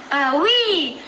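ordinary delivery

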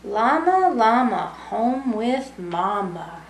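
gentle storybook read-aloud, lilting rhymes; reading to children. Acted. Teacher reading a bedtime book to young kids, lilting. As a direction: read it like bedtime for little ones.

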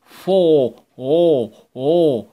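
fo- stretched into a round loud o; rounded o sound. Shown as a demonstration. Teacher showing children the rounded o in forty. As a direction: round the lips and hold the o. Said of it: "شوف، شوف بقك عامل شكله إزاي مدور".

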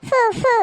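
two short puffs of breath; blowing. Acted. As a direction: blow on a burn to cool it. Blowing on burnt hands. (ஃபூ என ஊதுவது)

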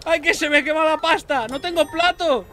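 high, loud desperate yell; panicked shout. Real, not acted. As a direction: wail it in kitchen panic. Pasta burning, no plates.

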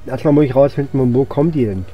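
ordinary talk

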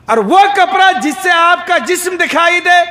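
high, forceful preacher's delivery. Real, not acted. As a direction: ring it out to the congregation, pitched well up. Preacher raises voice to warn. (ऊँची आवाज़ में जोशीली तक़रीर)

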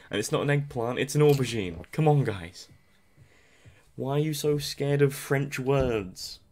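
hammed-up indignation, slowing at the end; mock exasperation. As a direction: scold the viewers in jest. Mock rant about French words.

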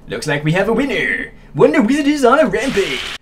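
high, rising wrestling announcer call; ring announcer. Pretend. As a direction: build to a loud high finish.

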